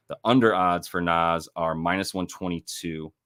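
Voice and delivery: ordinary talk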